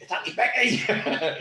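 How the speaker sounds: high, slow, laughing protest; indignant correction. Retold or imitated. Retelling how Becky corrected the kids, laughing. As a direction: stretch it out through a laugh, correcting them. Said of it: "And she's like".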